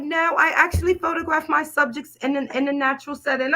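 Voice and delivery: high-pitched mock British lady. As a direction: put on a prim British accent, high. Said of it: "I gotta do my British lady".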